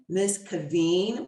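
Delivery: ordinary delivery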